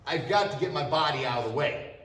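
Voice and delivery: normal talking voice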